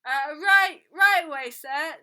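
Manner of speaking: loud, flustered call back. A narrator's reading. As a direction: shout a hasty reply across the room. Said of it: "Nino called back".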